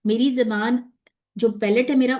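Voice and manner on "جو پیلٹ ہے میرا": ordinary talk, nothing marked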